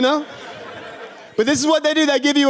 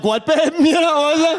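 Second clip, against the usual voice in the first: higher-pitched, mock-innocent answer to girlfriend; oblivious reply; 9 semitones higher than usual. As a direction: play a clueless boyfriend, pitched up. Acting out turning round to girlfriend.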